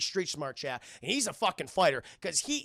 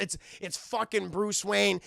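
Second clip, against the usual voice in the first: normal talking voice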